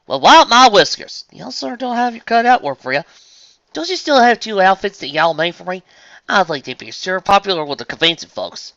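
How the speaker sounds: loud, high country twang; astonished southern farm pony. Acted. Farmer reacts to the deadline, astonished twang.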